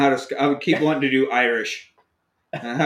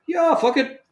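mock shrug; 13 semitones higher than usual. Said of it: "He's like".

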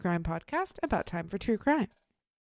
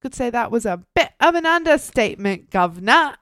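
bad British accent; 3 semitones higher, 7 dB louder than usual. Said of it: "chill with my bad accent".

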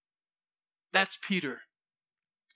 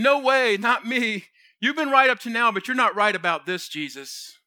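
raised, forceful denial; emphatic protest; 4 dB louder than usual. Acted. Peter swearing to Jesus he won't deny him, emphatic protest.

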